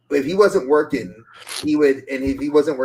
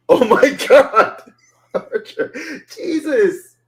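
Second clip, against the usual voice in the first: high-pitched exclamations broken by laughter; cracking up; 12 semitones higher, 5 dB louder, much slower than usual. Real, not acted. Host reacting to co-host's joke, cracking up. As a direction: laugh helplessly through the words, drawn out and high.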